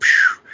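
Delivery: voiceless rushing "phew"; imitated whoosh. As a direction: make the noise of someone darting away.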